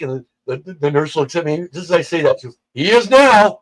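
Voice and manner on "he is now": loud, high comeback; exclaimed retort. Retold or imitated. Nurse answering staff who ask if he's awake, loud exclamation. Nurse's reply after the scream.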